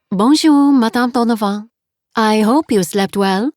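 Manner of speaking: cheerful French landlady, voice lifted high; French accent. Acted. Landlady greeting guest cheerfully, French accent. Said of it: "her accent was heavy".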